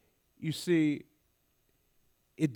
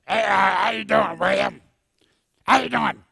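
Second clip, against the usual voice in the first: louder, imitated rough greeting from a neck tumor; distorted-voice impression; 5 semitones higher, 9 dB louder than usual. Wilberforce greeting William, louder imitated damaged voice. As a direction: greet him like the cool guy with a damaged voice box. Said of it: "He talked like this".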